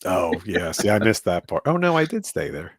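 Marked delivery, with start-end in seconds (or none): none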